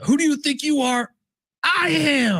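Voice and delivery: high triumphant bowler's boast; Pete Weber. Retold or imitated. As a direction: crow it like a champion bowler. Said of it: "Pete Weber in bowling when he says".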